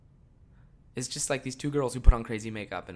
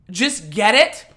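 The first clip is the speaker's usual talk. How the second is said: mock quote; 7 semitones higher, 9 dB louder, slower than usual. Mocking a commenter's advice.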